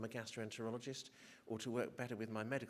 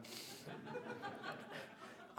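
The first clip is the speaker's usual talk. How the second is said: high airy laugh; 7 dB quieter than usual. Real, not acted. Laughing at her awkward aside.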